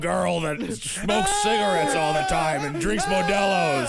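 put-on slow, rough shouted ghost girl; hammed-up croaky possessed child; poltergeist voice. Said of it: "You try Poltergeist voice".

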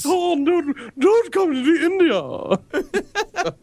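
high, very slow whiny creature voice; squeaky drawn-out plea, laughing; high silly creature voice. Pretend. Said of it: "every creature I imagine in my head sounds like".

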